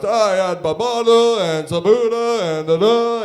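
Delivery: loud, high, hammed-up Italian mumble of dish names; Italian accent. Italian guy asked about dinner, loud hammed-up Italian accent. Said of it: "And he goes".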